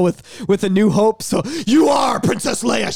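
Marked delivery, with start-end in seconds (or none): 1.7-2.9 slow, emphatic acted shout; hammed-up drilling command; mock shout. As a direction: boom it out slowly as a mock command. Acted shouted film-watching conditioning scene.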